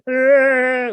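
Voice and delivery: a loud, high mock Tusken Raider yell; Tusken Raider cry. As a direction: bark it out like a desert raider. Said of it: "we were fucking Tusken Raiders from Star Wars".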